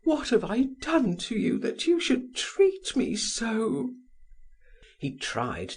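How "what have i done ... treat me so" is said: high, pleading exclamation; desperate lover exclaiming, voice raised high; exclaiming woman. A narrator's reading. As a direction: throw it at him as a reproach. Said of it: "she exclaimed".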